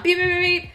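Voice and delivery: high-pitched string of beeps; rapid honks done by voice; imitated car honking. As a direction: mimic a car horn butting in. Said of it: "This dude is honking".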